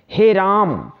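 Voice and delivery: हे राम with the vowels held extra long, like calling out; drawn-out call. Shown as a demonstration. Teacher showing how we call on God in trouble, drawn out. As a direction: call हे राम slowly, stretching each vowel. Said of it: "इससे तिगुना समय लगा रहे हैं".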